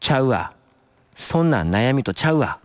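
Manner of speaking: assured retort in put-on Kansai dialect; confident fake Kansai dialect. Natsuko talking back about her worry, confident fake Kansai dialect. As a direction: answer back boldly in fake Kansai, a touch of friendliness. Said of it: "今度は堂々と、エセ関西弁で".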